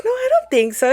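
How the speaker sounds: very high-pitched friend voice, brushing it off; acted high, dismissive denial.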